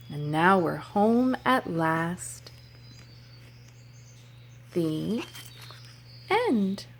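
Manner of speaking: rhythmic, sing-song story chant; reading to children. Acted. Teacher reading a nature rhyme to children, sing-song. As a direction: read it like a picture book for little kids.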